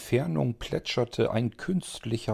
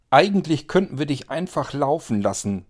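somewhat angry; 6 semitones higher, 5 dB louder than usual. Acted. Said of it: "sagte Franka nun etwas böse".

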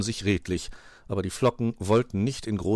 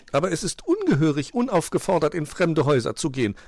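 high, loud lady magpie; prim magpie objecting; 9 semitones higher, 5 dB louder, faster than usual. A narrator's reading. Magpie warns against entering strange houses, objecting. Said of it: "gab Frau Elster zu bedenken".

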